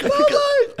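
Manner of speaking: Good morning pushed high through a laugh; high laughing lisp. Shown as a demonstration. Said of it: "only certain letters in where the lisp comes into play".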